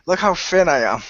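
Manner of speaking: ordinary talk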